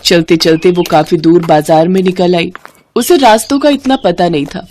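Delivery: ordinary delivery, nothing marked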